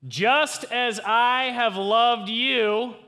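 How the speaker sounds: very slow, high, drawn-out yell. Shown as a demonstration. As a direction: yell each word out long and loud. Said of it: "I'm literally going to yell it".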